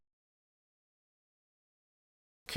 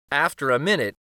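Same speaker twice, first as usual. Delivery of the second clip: usual speaking voice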